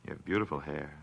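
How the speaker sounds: low, hushed, halting compliments; creepy intimate murmur; menacing admiration. Acted. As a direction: pause between each feature, quiet and low. Strangler admires his victim's throat.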